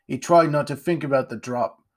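ordinary delivery

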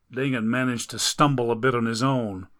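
ordinary delivery, nothing marked

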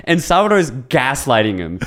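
usual speaking voice

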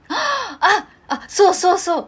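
gasp, then high loud delight; suddenly remember with a gasp; surprised gasp. Real, not acted.

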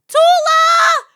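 high, loud call across the shop floor. Retold or imitated. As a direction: shout the name to find someone. Manager calling her across store.

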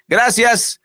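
mock polite refusal, high and drawn out; sarcastic quote. Mocking quote of resignation. (cita sarcástica)

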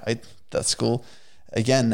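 usual speaking voice

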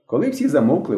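usual speaking voice

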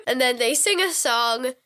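usual speaking voice

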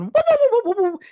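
loud, very high cartoon whooping; Zoidberg's panicked whooping. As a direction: whoop like Zoidberg scuttling around. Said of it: "scuttling around going".

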